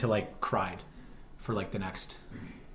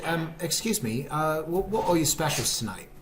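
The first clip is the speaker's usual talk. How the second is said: hammed-up British accent, slightly raised pitch; fake British accent; 5 semitones higher than usual. Diner asks the server for tonight's specials, in fake British accent. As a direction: put on British politeness for an order. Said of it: "doing a fake British accent".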